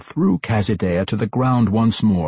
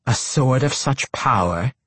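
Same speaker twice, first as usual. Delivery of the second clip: higher-pitched disbelieving retort; incredulous; 6 semitones higher than usual. A narrator's reading. Matron doubts Tosin's story, incredulous. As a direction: throw the question back in disbelief. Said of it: "Quenthel snapped back incredulously".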